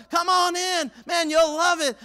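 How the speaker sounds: high, loud invitation; calling out. Retold or imitated. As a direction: coax them in. Swimmer calling again.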